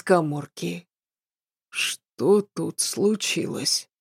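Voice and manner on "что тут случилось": slow, soft, drowsy question; sleepy voice. A narrator's reading. Servant feigning he just woke to the noise, sleepy voice. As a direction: act as if just woken up. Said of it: "проговорил он заспанным голосом".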